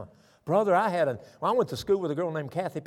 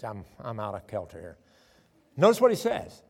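ordinary talk

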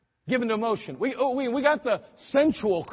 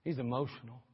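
usual speaking voice

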